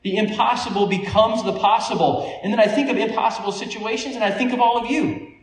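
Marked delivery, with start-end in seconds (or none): none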